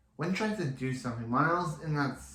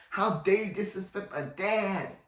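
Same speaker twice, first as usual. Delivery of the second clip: higher-pitched acted indignation; mock outrage, pitched up; indignant outburst; 7 semitones higher than usual. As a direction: act out an offended daughter's protest. Acts out Alex's angry comeback.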